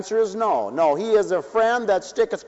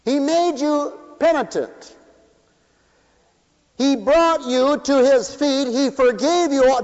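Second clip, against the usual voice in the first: preaching; 7 semitones higher, 4 dB louder than usual. Acted. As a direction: drive it home to the congregation. Preacher over music, rising.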